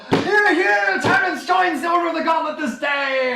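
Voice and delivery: high, loud shout to the hall; roaring celebratory announcement; jubilant proclamation. Acted. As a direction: proclaim it to everyone, hollering. Crusaders proclaim the new member.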